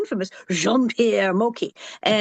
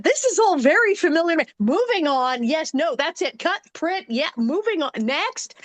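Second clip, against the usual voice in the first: higher-pitched, lightly acted set commands; mock director's calls; 6 semitones higher than usual. Actress recalling quick shoots on set, lightly mimicked director's calls.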